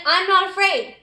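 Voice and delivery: loud and bold, chin up; brave shout. Shown as a demonstration. Teacher modeling a brave voice for the kids, loud. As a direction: say it like you mean it, not afraid. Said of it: "Can you be like her?".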